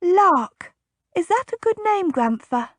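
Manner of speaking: put-on a small girl asking her grandfather; high childlike question; eager little girl. A narrator's reading. Young girl naming the foal.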